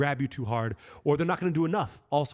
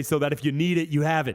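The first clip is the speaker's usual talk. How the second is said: ordinary talk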